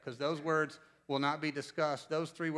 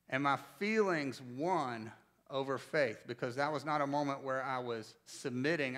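ordinary delivery

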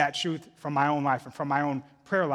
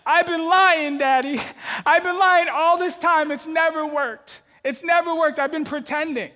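loud, high mock sobbing child wail; hammed-up tearful outburst, high and loud; imitated crying confession; 12 semitones higher, 8 dB louder than usual. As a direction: wail it like a melodramatic little girl. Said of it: "now she's crying uncontrollably".

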